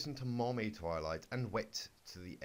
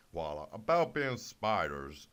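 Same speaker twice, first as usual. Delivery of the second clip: lower, slow, sheepish pony; gruff Big Mac; 7 semitones lower, 4 dB louder, slower than usual. Acted. Big Mac mumbles to Twilight low and slow.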